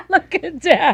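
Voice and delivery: laughing while talking; words through a laugh. Real, not acted. Laughing at photo.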